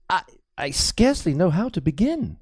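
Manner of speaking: put-on nervous young priest apologizing to Crispin; high, timid priest, anxious and halting; fearful young priest. A narrator's reading.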